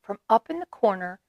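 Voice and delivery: ordinary delivery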